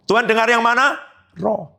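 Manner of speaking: usual speaking voice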